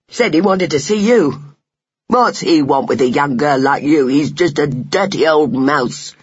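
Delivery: scornful, rough-mannered rival justifying himself; contemptuous mouse bully, emphatic; bullying young mouse. Acted. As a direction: sneer about the intruder. Voices bullying mouse Buck.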